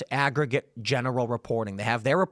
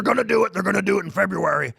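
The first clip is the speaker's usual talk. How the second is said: imitating Alex Jones; 3 semitones higher, 3 dB louder, faster than usual. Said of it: "video of him saying".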